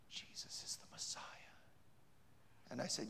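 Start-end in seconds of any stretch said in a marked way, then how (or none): 0.1-1.2 hushed whisper of a confession. Retold or imitated. She confesses in a whisper to the preacher, hushed. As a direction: breathe it out in a whisper. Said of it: "She whispered".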